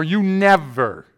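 loud, stretched mock blame; exaggerated quarrelling outburst; angry accusation. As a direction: throw it out accusingly, dragging NEVER.

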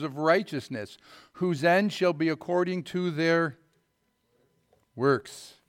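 solemn King James reading; scripture reading. Acted. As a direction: read the verse out like a preacher. Reads Scripture to congregation.

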